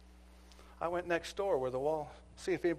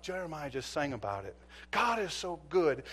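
ordinary talk

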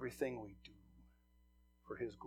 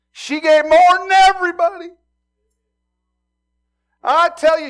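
much higher, loud declaring voice; proclaiming shout; 21 dB louder than usual. Retold or imitated. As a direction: cry it out as Jesus proclaiming. Jesus proclaims to disciples.

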